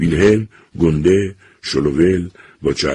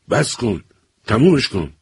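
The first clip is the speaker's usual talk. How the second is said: higher, sharp command; father banging the table, commanding; 6 semitones higher than usual. A narrator's reading. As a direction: order him to stop now. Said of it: "و آمرانه روی میز کوبید".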